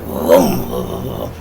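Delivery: low "vroom" of a boat starting; deep imitated motor revs; motor noise. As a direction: imitate an engine rumbling, then fading.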